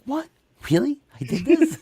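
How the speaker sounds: high, loud disbelief at the end; building mock astonishment; surprised, amused. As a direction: imitate someone astonished at themselves. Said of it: "very surprised, but still laughing".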